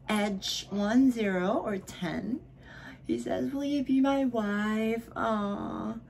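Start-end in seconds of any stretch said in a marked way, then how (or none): none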